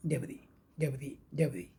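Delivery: डेब्री said with no final s, ending on a long ee; silent s in debris. Shown as a demonstration. Teacher showing students डेब्री without the s. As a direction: say डेब्री, dropping the s sound. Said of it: "डेब्री पढ़ते हैं ना कि डेब्रिस".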